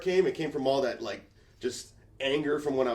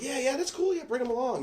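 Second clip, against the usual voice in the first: much higher, fake breezy; mock nonchalant, pitched way up; fake casual; 14 semitones higher than usual. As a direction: act like you don't care. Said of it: "played it off like I didn't care".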